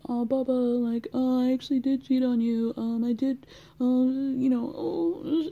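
high, fast, whiny confession voice; sheepish Tom impression. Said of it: "come to her in a dark corner ... and say".

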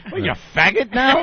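high, loud mocking mother; hammed-up mom scoffing at her son. Mother mocking her son's new fancy life, loud and high. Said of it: "my mother's gonna be like".

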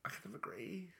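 whispered guilty agreement. Real, not acted. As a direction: breathe it like a secret.

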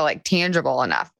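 ordinary talk

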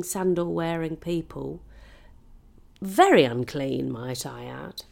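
ordinary delivery

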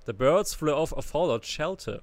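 ordinary talk, nothing marked

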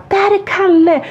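high, excited shout of recognition; excited call-out. Retold or imitated. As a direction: call out as if spotting a friend on the slope. Spotting Kalle, calling out.

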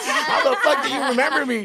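high, slow, loud and laughing through it; delighted disbelief, laughing; laughing amazement. Retold or imitated. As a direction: marvel out loud while cracking up. Amazed a client remembers him.

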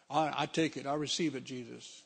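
ordinary talk, nothing marked

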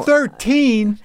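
high-pitched, incredulous question; shocked disbelief. Real, not acted. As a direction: repeat it back in stunned astonishment. Shocked at her age then.

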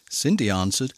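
plain reading voice

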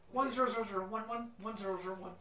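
rapid chirpy robot beeping numbers; robot saying binary. Acted. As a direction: rattle off binary like a droid. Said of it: "it's like R two D two language".

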